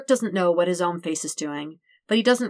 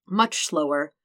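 usual speaking voice

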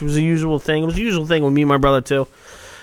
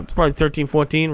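usual speaking voice

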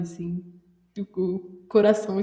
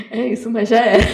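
laughing; 2 semitones higher, 8 dB louder, faster than usual. Retold or imitated. Ironic self quote with laughter.

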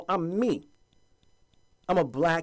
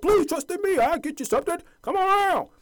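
high, loud mock sales pitch; hammed-up high promise voice; smooth-talking politician; 4 dB louder than usual. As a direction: coax them in like a con man. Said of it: "folks who come around talking about".

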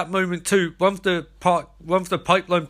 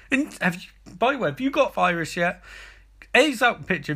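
ordinary talk

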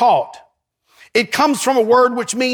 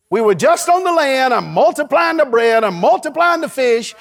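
ordinary talk

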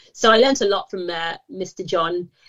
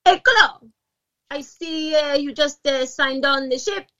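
put-on Italian accent; 5 semitones higher, slower than usual. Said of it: "an Italian speaking to me".

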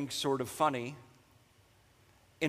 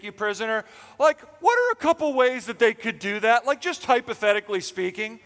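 higher, louder, wheedling mock Delilah; 5 semitones higher, 5 dB louder than usual. Preacher mimics Delilah pestering Samson, mock sweet. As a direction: play a sly girlfriend fishing for his weakness.

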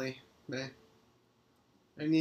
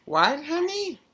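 calling back; 12 semitones higher, 12 dB louder than usual. Real, not acted. Answering a call from afar.

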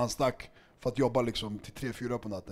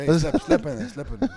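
laughing mock protest; 9 semitones higher, 8 dB louder than usual. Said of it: "Man bara".

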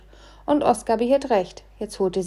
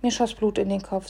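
ordinary delivery

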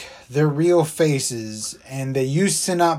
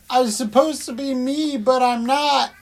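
high, whiny fake sob; mock sob story; 10 semitones higher than usual. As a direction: whine it out like a hammed-up weeping kid. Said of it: "sob story starts coming in".

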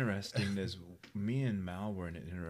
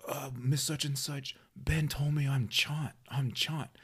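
high, whiny, slow tattling; boy tattling to the teacher, high and slow; tattling kid; 6 semitones higher, 4 dB louder, slower than usual. Retold or imitated. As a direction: tug the teacher's dress and whine it out. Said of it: "pulled on her dress and goes".

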